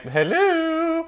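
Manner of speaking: high, sing-out hello; tipsy greeting. Retold or imitated. Woman arriving home after drinks, high playful greeting.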